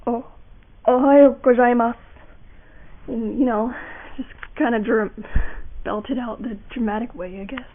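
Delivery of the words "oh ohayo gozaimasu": stammered start, then louder greeting; stuttering anime line. Shown as a demonstration. Mimicking an anime character, stuttering then belting. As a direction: stutter in, then belt it out dramatically. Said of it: "they stutter a lot".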